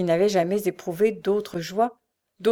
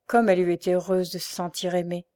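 usual speaking voice

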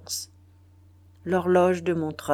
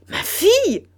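much higher, loud cry of horror; horrified cry; 14 semitones higher, 8 dB louder than usual. A narrator's reading. The general cries out in horror on learning of his daughter. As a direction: recoil in horror at the word. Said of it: "s'écria le général en jetant sur Hélène un coup d'œil d'horreur".

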